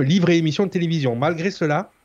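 ordinary delivery

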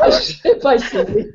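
high, slow, cracking up; barely getting words out for laughing. Real, not acted. Duck glue question makes her laugh.

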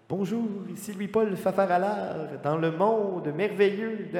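mild game-show host impression; Louis-Paul Fafaralard. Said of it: "Je veux faire comme Louis-Paul Fafaralard".

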